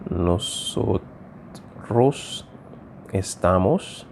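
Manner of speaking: NOSOTROS softly, with gaps between syllables; quiet, halting syllables in NOSOTROS; broken into syllables. Shown as a demonstration. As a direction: say NOSOTROS piece by piece, as if writing. Writes the word out once more.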